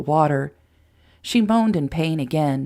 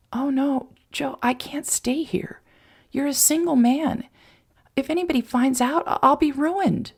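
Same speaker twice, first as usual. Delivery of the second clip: put-on higher young woman's voice in sudden alarm; panicked girl, dropping to a hush then rushing; Mary Sue voice, alarmed; 9 semitones higher than usual. A narrator's reading. Mary Sue alarmed to Joe about being ruined, higher.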